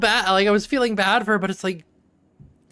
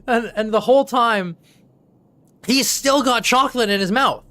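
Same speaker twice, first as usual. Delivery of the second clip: normal talking voice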